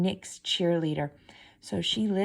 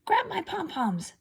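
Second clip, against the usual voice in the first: much higher demanding voice; bossy stepsister; 12 semitones higher than usual. A narrator's reading. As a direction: order her around in a high voice. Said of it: "said one stepsister".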